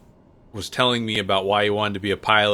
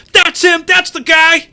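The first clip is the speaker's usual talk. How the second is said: high, loud calling out across a lobby; retold excited shout; 13 dB louder than usual. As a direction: yell it like you've spotted someone famous.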